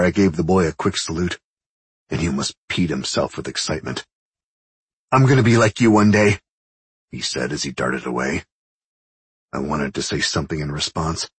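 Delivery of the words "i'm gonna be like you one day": excited. A narrator's reading. Boy darting away excited.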